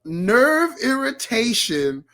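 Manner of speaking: slow, each word spelled out; slow emphatic reread. Shown as a demonstration. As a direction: read it slowly for effect. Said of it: "Can you read that again?".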